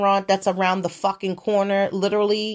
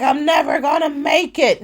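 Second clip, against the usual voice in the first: loud crying wail; lady desperate for the bathroom, wailing; 5 semitones higher, 4 dB louder than usual. Retold or imitated. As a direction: wail it like she's doomed. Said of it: "She was like".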